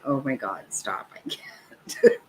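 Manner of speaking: trailing into a breathless whisper; faint laughing plea. Retold or imitated. Mock quote, laughing disbelief.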